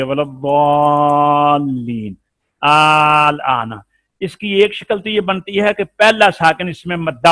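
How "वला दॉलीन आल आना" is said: slow recitation, दॉलीन stretched long; recited with madd. Shown as a demonstration. Teacher demonstrates the long madd, slowly reciting. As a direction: draw out वला दॉलीन in tajweed. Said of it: "तो ये पढ़ी जा रही है, मद हो रही है".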